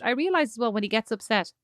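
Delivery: ordinary talk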